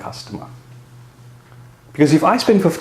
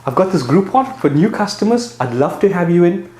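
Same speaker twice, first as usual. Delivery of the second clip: a higher, upbeat sales pitch to new customers; 7 semitones higher than usual. Retold or imitated. Gym owner pitching a Groupon trial to new customers, upbeat and higher. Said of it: "So I will say".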